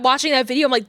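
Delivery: ordinary talk, nothing marked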